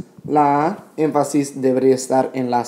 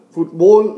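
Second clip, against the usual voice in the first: "Fútbol" mis-stressed as fut-BOL; stress on last syllable; 5 semitones higher, 6 dB louder than usual. Shown as a demonstration. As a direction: put the weight on BOL in "Fútbol". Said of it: "Eso no es una palabra".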